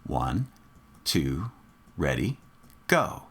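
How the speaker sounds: slow, evenly spaced low count, rising on go. Shown as a demonstration. Teacher cueing students to start together, measured count-off. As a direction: count them in on a steady beat. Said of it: "I'll count off first".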